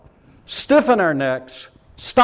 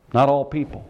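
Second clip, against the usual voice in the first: ordinary talk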